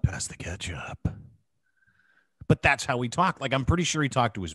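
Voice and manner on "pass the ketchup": low, whispery laughing murmur; imitating Jeff Woods with a laugh. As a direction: breathe it out deep like the DJ. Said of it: "when you say 'pass the ketchup'".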